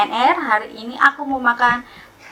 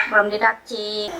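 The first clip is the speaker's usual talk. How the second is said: usual speaking voice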